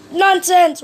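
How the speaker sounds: Mister Busy waving away his neighbour's complaint; brisk dismissal. Acted. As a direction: brush the objection aside. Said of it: "replied Mister Busy".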